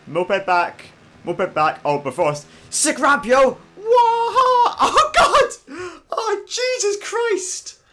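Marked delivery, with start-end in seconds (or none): 2.7-7.8 high, loud whooping over a stunt; excited yelling. Real, not acted. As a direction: yell with glee as the bike flies. Moped jumps ramp, crashes.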